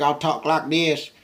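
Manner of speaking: high, loud, slow character voice; put-on work voice. Shown as a demonstration. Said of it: "my work voice be like this".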